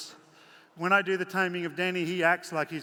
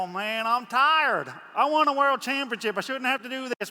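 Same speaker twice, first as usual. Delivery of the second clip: higher, whiny tired horse complaining; lazy champion horse voice; 6 semitones higher, 4 dB louder than usual. Retold or imitated. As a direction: play a spoiled champion horse who won't work. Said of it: "You go".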